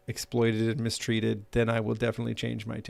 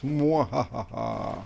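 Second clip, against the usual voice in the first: evil villain laugh; much slower than usual. Acted.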